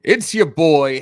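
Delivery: loud, punchy greeting to the viewers; hype show intro. Acted. Host opening his live stream to fans, loud hype greeting. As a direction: throw the welcome out like a radio host.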